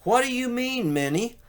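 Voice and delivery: high, puzzled exclaim; startled question up high; surprised high what. Acted. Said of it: "Mickey exclaimed".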